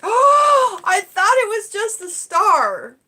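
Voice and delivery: gasp, then high and loud in delight; real astonished delight; surprised gasp. Real, not acted. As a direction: caught off guard by the gift. Gift unwrapped, gasps.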